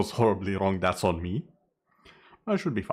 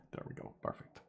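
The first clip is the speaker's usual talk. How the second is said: breathy whisper to self; 17 dB quieter, faster than usual. Real, not acted. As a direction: whisper it to yourself. Whispered aside while adjusting crown.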